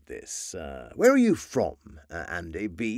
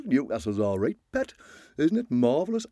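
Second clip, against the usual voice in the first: put-on Newcastle accent; 3 semitones higher, faster than usual. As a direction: have a go at Geordie. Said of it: "I could do Newcastle".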